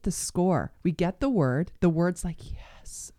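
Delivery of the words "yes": breathy whispered yes; a hushed, awed whisper. Pretend. Voicing God's promise, whispered.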